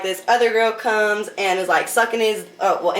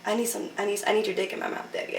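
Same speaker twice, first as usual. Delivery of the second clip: hushed, breathy-sounding begging voice, much softer; sultry whisper-soft plea; 8 dB quieter, faster than usual. Retold or imitated. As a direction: lean in and beg softly like a seductive tease. Sultry plea to daddy, retold.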